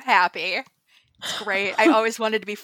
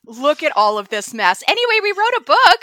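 bright and loud, much higher; excited; 9 semitones higher, 9 dB louder than usual. Real, not acted. Host excitedly announces to listeners they wrote a book. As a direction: gush with excitement about the book. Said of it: "Super excited".

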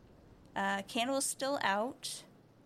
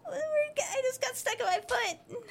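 high startled cry; startled yelp; 14 semitones higher than usual. Real, not acted. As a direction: cry out as something jumps you. Sudden scare in horror game.

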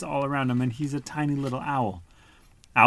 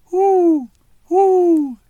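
high, loud owl hoots; 12 dB louder than usual. Acted. Owl calls toward the sound, high loud hoots. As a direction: hoot twice like a calling owl. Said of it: "he called".

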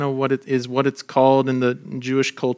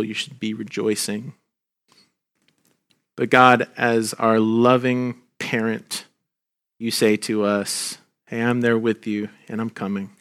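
closing prayer; 2 semitones lower than usual. Acted. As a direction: pray gently for the grieving. Pastor leads closing prayer.

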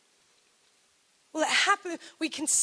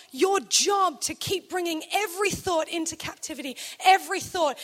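emphatic shout. Real, not acted. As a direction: drive it home to the room. Preacher's emphatic exhortation.

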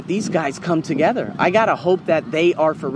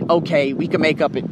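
ordinary talk

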